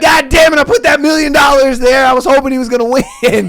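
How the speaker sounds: high, loud griping as the voice; acted frustrated outburst. Booming voice regrets the million bet, frustrated mock outburst. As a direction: complain like a gambler who just lost. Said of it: "He's like".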